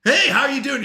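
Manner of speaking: bright, high and loud friendly hello; mock cheerful hey there; cheery Southern greeting. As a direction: greet a stranger like a chatty Southerner.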